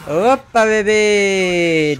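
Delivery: loud high gleeful call; gleeful taunt. Real, not acted. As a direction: pounce on the victim gleefully. Spotting a victim.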